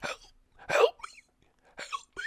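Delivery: high, strained calls for help; mock cry for help. Dreamer yelling for help in a nightmare, high and strained. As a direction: call out as if in danger. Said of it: "you're yelling and you're just like".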